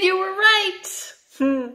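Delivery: slow, high, stretched-out praise; delighted praise. Acted. Teacher praising children's right guess, high and slow. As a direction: reward the kids' guess with a high, drawn-out cheer.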